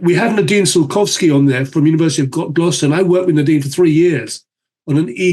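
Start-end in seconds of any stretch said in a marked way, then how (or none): none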